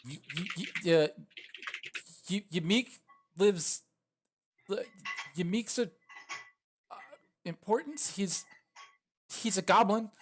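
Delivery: high, halting false starts; stuttering goblin. Acted. Droop confused by the question, stuttering. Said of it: "stutters it out".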